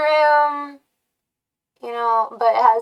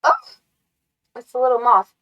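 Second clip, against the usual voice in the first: usual speaking voice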